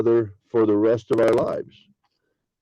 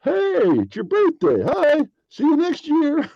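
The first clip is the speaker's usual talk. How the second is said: high, slow, sing-song hey it's your birthday; much higher mock-cheery birthday greeting; perfunctory birthday greeting; slower than usual. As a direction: chirp it like an obligatory Facebook wish. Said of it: "like Facebook friends".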